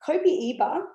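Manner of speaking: Copiaeba with a Mexican accent, rising at the end. Shown as a demonstration. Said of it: "I say it in a Mexican accent".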